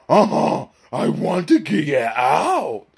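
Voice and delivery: slow, throaty talking-dog voice; Scooby-Doo. Acted. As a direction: drag it out like a whimpering cartoon dog. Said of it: "same thing with for Scooby".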